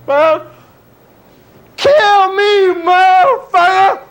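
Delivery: loud, crying yell at the world; tearful angry outburst. Acted. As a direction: break into a sobbing shout.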